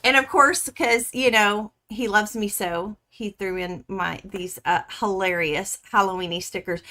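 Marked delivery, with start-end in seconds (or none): none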